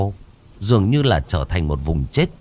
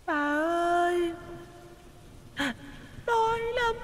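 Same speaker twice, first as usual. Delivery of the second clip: very high, much softer, slow drawn-out eerie plea; slower plaintive ghost child; 13 dB quieter, slower than usual. A narrator's reading. Ghost child repeating its plea, slower, very high and faint. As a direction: stretch the hungry whimper out. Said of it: "Nó cất tiếng nói thê thiết".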